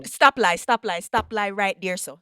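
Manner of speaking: Jamaican patois. Acted. Host playfully accusing guest in Jamaican patois. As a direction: tease her in Jamaican patois.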